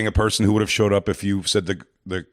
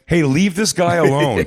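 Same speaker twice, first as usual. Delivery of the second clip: higher, slow mock telling-off; mad cop; 8 semitones higher, 3 dB louder, slower than usual. Cops telling the sting guy off, annoyed. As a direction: protest like an exasperated cop. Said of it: "get mad at the guy who's stinging him".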